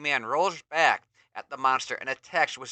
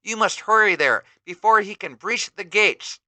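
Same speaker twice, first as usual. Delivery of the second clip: higher, louder urging; urgent plea; 7 semitones higher, 6 dB louder than usual. Acted. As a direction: press the hero to hurry. Sorceress urges hero.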